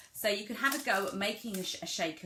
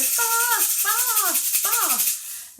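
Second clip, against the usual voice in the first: high, loud, urgent bursts; excited calls to match quick playing; demonstrating fast; 13 semitones higher, 8 dB louder, much slower than usual. Shown as a demonstration. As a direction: fire each word out high and loud. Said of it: "if they're being fast".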